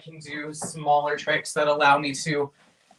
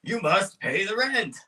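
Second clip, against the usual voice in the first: villain voice; 4 semitones higher, slower than usual. Acted. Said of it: "the classic".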